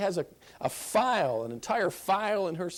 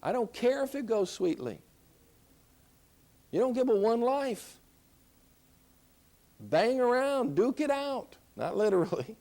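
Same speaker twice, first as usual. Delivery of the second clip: normal talking voice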